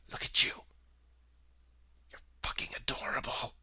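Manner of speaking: unvoiced whisper to the dog. Real, not acted. Whispering to his pet dog, hushed. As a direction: coo it softly under the breath.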